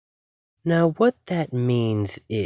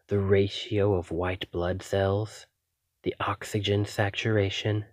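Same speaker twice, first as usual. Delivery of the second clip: ordinary talk, nothing marked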